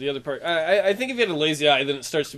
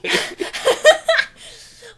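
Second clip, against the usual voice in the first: loud, high burst of laughter; 6 dB louder than usual. Real, not acted. Co-hosts joking about a lazy eye, real high laughter.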